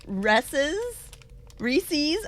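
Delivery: ordinary talk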